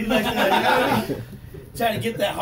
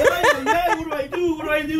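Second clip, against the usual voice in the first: high, loud flustered cries; mock panic; 7 semitones higher, 6 dB louder than usual. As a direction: fluster like a kid in a mess. Young self panicking.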